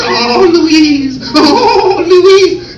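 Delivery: high, loud, laughing wail of the name; laughing Oh Louise. Retold or imitated. Comic mimicking the lady, laughing. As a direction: cackle the name out. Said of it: "he says".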